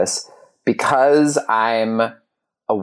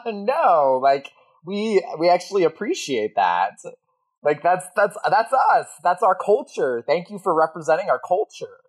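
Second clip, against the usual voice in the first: imitating grateful locals; 8 semitones higher than usual. Latins laughing, thanking him.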